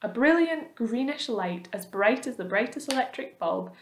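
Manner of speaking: usual speaking voice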